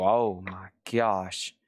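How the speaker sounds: low, slow spooked gasp; drawn-out frightened whisper; scared. Pretend. Mock scared at shadow.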